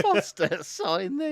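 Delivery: high, loud mock demand; rude autograph hunter. Teasing the footballer as a rude fan, high mock demand. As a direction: mimic a pushy fan, pitched right up.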